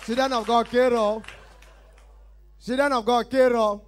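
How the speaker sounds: high, loud repeated hail; loud crowd call. Acted. Comedian hailing the children of God, loud call. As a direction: call it out across the hall. Comic calls out catchphrase to crowd.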